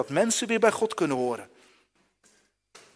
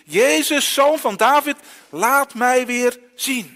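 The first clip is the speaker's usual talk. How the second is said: loud, higher cry, slow at the end; calling out; 7 semitones higher, 8 dB louder, slower than usual. Acted. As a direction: shout it over the crowd. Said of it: "als hij roept tot Jezus".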